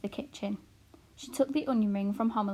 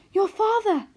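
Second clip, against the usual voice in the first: much higher and louder, flustered; excited exclamation; 12 semitones higher, 10 dB louder than usual. A narrator's reading. Mother flustered at her husband's return, loud and high. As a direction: cry out as he arrives. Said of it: "exclaimed Homily".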